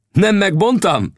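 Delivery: high, drawn-out cry of vindication; triumphant exclamation. A narrator's reading. Old scout hears the howling and exclaims he was right, triumphantly. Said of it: "kiáltott fel az öreg".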